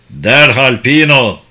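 loud, slow, emphatic order; commanding shout. A narrator's reading. General Leyers demands that Pino obey at once, commanding shout. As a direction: draw out each word as a forceful command. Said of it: "küçümseyen gözlerle bakıp bağırdı".

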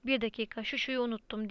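usual speaking voice

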